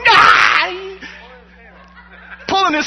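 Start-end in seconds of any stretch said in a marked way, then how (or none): 0.1-0.5 high loud panicked yelp; mock terrified scream. Devil fleeing in emotional distress, mock scream. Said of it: "this guy going".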